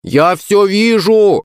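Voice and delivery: loud drawn-out shout. Acted. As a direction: call it out slowly. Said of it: "крикнул я".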